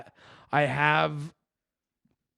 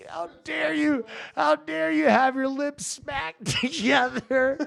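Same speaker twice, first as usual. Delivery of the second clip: mock outraged dad; 11 semitones higher than usual. Dad mocked over eating noise.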